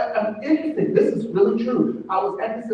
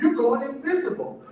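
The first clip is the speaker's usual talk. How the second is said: normal talking voice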